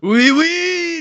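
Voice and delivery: high, loud cartoon French squeal; Pepe Le Pew. Co-host joining the cartoon skunk joke, mock French. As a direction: trill it like an amorous French cartoon. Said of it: "Pepe Le".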